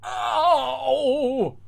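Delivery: high loud panicked wail; screaming. Acted. Girl whose brain hurts, screaming. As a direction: shriek in pain and panic. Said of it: "then screams".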